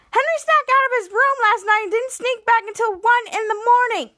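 high, loud blurted tattling; imitating an excited tattletale blurt; bursting out. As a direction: burst out with the secret in one rush. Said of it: "Piper just bursted out".

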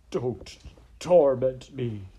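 slow, tearful protest; anguished. Acted. Sniffing rat begs the princess, anguished. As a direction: drag it out, loud on torment. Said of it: "The rat sniffed".

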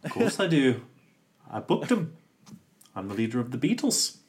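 mock Liverpool accent. Host pretending to be a Beatle talking to [name], mock Liverpool accent. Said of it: "doing Liverpool accents".

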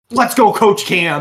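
loud, slow hyped-up cheer; cheering on, drawn out and loud; mock cheer. Friend mimicking a golf buddy's loud cheer.

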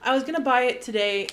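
ordinary talk, nothing marked

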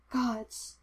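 soft, breathy-quiet gasp of awe; whispered. A narrator's reading. Obi-Wan overwhelmed by the vision, whispered. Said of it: "he whispered".

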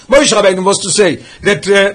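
ordinary delivery

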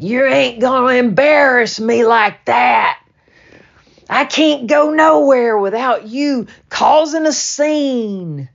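a father in a rage scolding his daughter; furious father shouting while beating his child; raging father. Retold or imitated.

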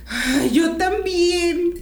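high, loud and slow, drawn-out relief; hammed-up high delighted agreement; eager agreement. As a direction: stretch it out like a relieved friend. Imitating mothers agreeing eagerly.

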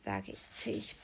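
ordinary delivery

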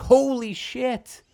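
high, slow, drawn-out shock; stunned kid. Retold or imitated. Remembering watching a guitar smashed over a head as a kid, stunned.

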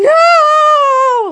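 long, loud, very high wail of refusal; acting out a piercingly high, drawn-out scream; re-enacted scream. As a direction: let out one sustained panicked scream. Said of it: "And I'm just screaming".

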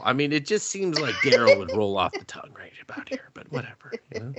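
whispered aside. Real, not acted. Joking aside to the co-host about the name, whispered. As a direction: whisper it like a private joke.

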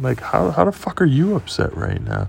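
high-pitched retold indignation; exasperated disbelief. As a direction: demand an answer, voice pitched right up. Acts out arguing with upset girlfriend.

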